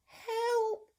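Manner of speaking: high squeaky cry for help; scared cry. Acted. Storyteller voicing the scared cook, a high squeak. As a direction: yelp in panic.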